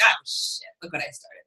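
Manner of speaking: whispered dismay; under-breath mock horror. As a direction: hush it like a guilty aside. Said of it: "whispered realization".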